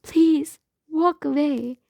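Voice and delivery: high, loud frightened begging; desperate plea. A narrator's reading. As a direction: plead with him to leave. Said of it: "my voice pleading".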